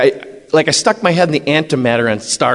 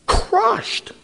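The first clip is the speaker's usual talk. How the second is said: anguished echo; 4 dB quieter than usual. Real, not acted. Echoing shattered dreams.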